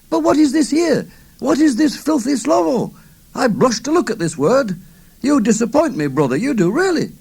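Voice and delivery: high, loud, put-on shocked voice; mock shocked. A narrator's reading. Alex mocking the old man over his book, mock shocked. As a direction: feign outrage at a dirty word. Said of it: "in a very shocked type golos".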